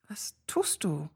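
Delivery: soft breathy whisper, barely voiced; breathed whisper. Sophie whispers to him, breathless from the near kiss. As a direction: breathe the question out under the breath. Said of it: "hauchte ich".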